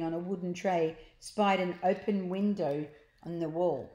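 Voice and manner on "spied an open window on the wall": ordinary delivery, nothing marked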